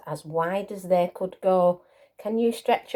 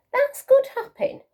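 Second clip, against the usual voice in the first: little hare voice; 5 dB louder than usual. Acted. Little hare's thought, voiced high.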